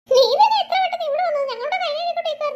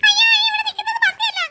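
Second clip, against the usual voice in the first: high frightened cry; girl shrieking at the monster; scared shout; 6 semitones higher than usual. Acted. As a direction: yelp in fear.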